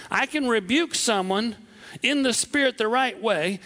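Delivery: ordinary talk, nothing marked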